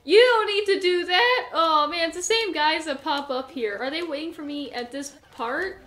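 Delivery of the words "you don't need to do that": loud, high exasperated shout; frustrated yell. Real, not acted. Gamer yelling at enemies, loud exasperated shout. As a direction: yell it at the screen in disbelief.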